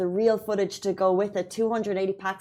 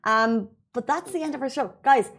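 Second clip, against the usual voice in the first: ordinary delivery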